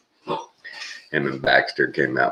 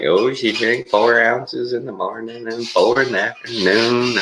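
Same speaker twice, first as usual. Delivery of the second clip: imitating daycare staff; 8 semitones higher than usual. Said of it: "they're like".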